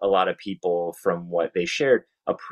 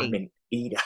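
imitated Australian accent; 6 dB quieter, faster than usual. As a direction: softly echo her Australian accent. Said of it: "that just sounded different".

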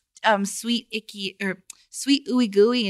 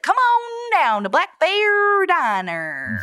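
put-on slow, higher country accent ad; Country [name] accent; 9 semitones higher, slower than usual. Doing the country accent for the diner ad, higher and slow. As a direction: drawl it like a country diner commercial. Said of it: "So I would be like".

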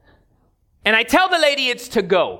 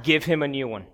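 tired manager; 9 semitones lower, 5 dB quieter than usual. Retold or imitated. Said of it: "she's like".

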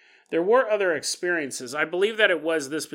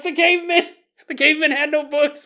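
much higher, loud crazed shout; shouting proclamation; 9 semitones higher, 7 dB louder than usual. Acted. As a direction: yell it at passersby. Yelling monologue while spinning.

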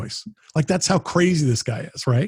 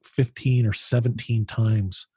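ordinary talk

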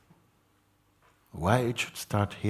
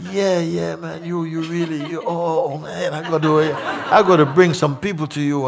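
higher, louder, laughing enthusiasm; laughing admiration; 10 semitones higher, 8 dB louder than usual. Retold or imitated. Teacher laughs off Mooji's question, high and excited. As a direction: brush it off with giddy laughter. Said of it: "do you get the point or not".

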